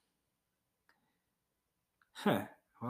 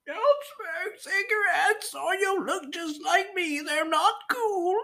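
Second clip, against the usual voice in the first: much higher, very slow, tearful put-on voice; mock sad smoker PSA; slower than usual. Parodying an anti-smoking commercial, high, slow and teary. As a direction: whine it like a crying anti-smoking ad. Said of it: "one of those sad smoker commercials".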